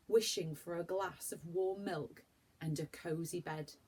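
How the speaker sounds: usual speaking voice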